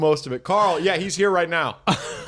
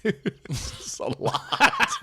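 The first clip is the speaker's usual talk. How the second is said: high, slow, laughing through the words; amused disbelief, laughing as you speak; 7 semitones higher, slower than usual. Retold or imitated. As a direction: chuckle it out, overwhelmed. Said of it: "I'm like".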